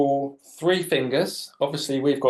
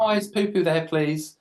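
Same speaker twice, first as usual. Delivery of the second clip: cheeky prank voice; 8 semitones higher than usual. Retold or imitated. Kid prank caller voice.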